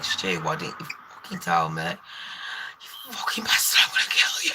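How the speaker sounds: hissed whisper, slowing right down on the swearing; furious whisper. Retold or imitated. On the phone in the hallway, hiding her fury from the client, whispered. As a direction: spit it out low so nobody in the house hears. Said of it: "I was like".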